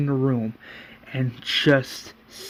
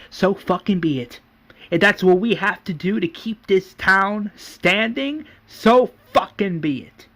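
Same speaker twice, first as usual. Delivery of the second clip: raised-pitch, forceful defiance; defiant anger; 6 semitones higher than usual. Acted. Governor vows to keep the town standing, defiant. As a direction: punch the words with angry resolve.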